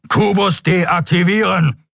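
high, slow, shouted command; thundering order. A narrator's reading. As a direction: thunder the order to cut the link. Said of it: "donnerte der schwarze Skria".

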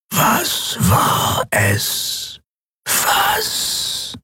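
slow, breathless halting whisper that stumbles; a ruined machine groping for its lost task; whispering broken machine mask. Acted. Maske flüstert verzweifelt. (stockend flüsternde Maske, verwirrt)

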